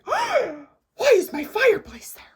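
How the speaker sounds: high, loud startled question; acted-out alarmed bewilderment; shocked disbelief. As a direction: cry out as if jolted awake in the wrong place. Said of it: "you're like".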